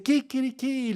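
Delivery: high mock rooster call; rooster crow. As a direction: crow it like a rooster announcing arrival. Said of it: "un gallo dice". (canto de gallo agudo, imitado)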